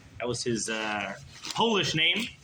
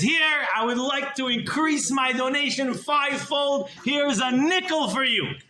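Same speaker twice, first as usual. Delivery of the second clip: much higher, slow and grand; proud miser; 9 semitones higher than usual. Acted. Miser proudly handing a nickel to the rebbe, higher and slow. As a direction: present it like a fortune.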